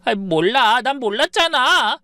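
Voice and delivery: high, loud bawling protest; tearful whine. Acted. Daughter bursting into tears after the scolding, high and loud. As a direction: wail like a caught child. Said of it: "엉엉 울음을 터뜨리대요".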